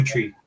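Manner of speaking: "tree" with a plain t sound; Pidgin pronunciation. Shown as a demonstration. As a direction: count one, two, "tree" the local way. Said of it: "That's how we say it".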